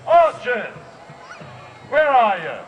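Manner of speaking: loud call across the field; loud summoning call. Real, not acted. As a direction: summon the archers with a shout. Calling out to the archers.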